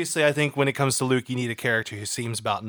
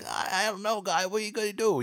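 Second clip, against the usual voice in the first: higher, softer shy-guy voice; shy mumbling guy; 6 semitones higher, 5 dB quieter than usual. Retold or imitated. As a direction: play it timid and unsure. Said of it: "or he could be very like".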